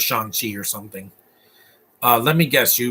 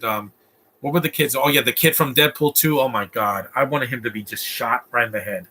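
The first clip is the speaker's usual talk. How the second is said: ordinary delivery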